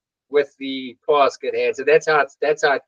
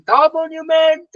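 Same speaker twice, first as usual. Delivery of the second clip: mock dream shout; 4 dB louder than usual. Runner retelling his nightmare about the race, mock shouting. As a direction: cry it out high, like calling from a dream. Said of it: "I still dream a dream shouting".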